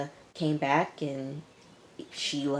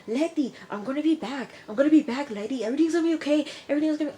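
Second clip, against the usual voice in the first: imitating Coco, pitched much higher, a bit louder on the promises; a hammed-up, high voice promising he'll be back; mock reassuring promise; 11 semitones higher, 3 dB louder, much faster than usual. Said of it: "And he says".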